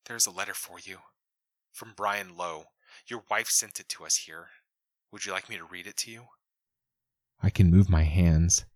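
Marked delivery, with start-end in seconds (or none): 0.1-6.3 much softer, careful woman's voice; gentle deputy. A narrator's reading. Deputy on the comm offering to read the letter, soft and gentle. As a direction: offer it softly, like bad news.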